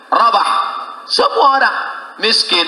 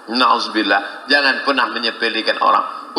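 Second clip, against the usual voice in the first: usual speaking voice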